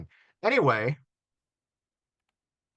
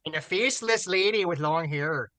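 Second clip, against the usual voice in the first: put-on Minnesotan accent; 4 semitones higher, 4 dB louder than usual.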